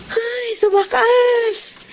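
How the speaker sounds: high, drawn-out happy sigh of words; joyful relief. Acted. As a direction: savor it, finally. Relief at returning to college.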